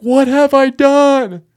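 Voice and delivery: long, loud, high wail of horror; horrified werewolf man. Acted. Werewolf man discovering his bloody hands, horrified wail. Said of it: "he's just like".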